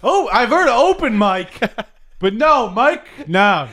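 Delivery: loud, high infomercial voice; high pitchman voice. Acted. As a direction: bellow it like a TV pitchman. Billy voice continues.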